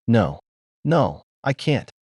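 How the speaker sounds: slow, understated tearful refusal; tearful cry. A narrator's reading. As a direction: cry out in despair, lightly acted. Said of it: "he cried out in tears".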